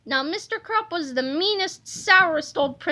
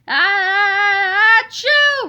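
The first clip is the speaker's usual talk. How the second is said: higher, louder sneeze chanted back; sing-song sneeze; 4 semitones higher, 8 dB louder, much slower than usual. Acted. Fans echoing the cheerleaders with a higher, louder sung sneeze. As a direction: echo the sneeze like a crowd chant. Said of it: "repeated the fans".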